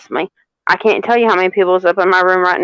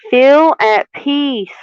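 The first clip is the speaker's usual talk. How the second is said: slow card reading; 4 semitones higher, much slower than usual. Acted. Reading card message slowly.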